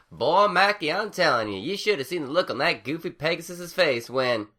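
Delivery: bursting in mid-story with a laugh; lively country farm mare; excited farm mare. A narrator's reading. Applejack figure voice.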